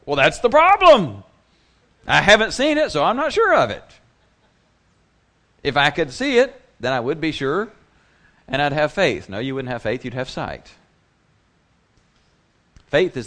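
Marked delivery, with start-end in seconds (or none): 0.1-9.3 loud, high mock objection of a doubter; doubting skeptic. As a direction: play the frustrated doubter, much higher and louder. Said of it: "we hear that and go".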